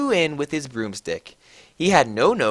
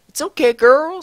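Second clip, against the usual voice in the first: much higher, louder friend; high girly voice; 12 semitones higher, 8 dB louder than usual. A narrator's reading. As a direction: squeal it sweetly. Bloody Mary consoling.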